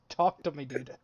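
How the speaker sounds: ordinary talk, nothing marked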